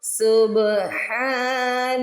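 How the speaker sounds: slow melodic dua recitation; slow recited Arabic prayer. Acted. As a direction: recite it devotionally and slowly.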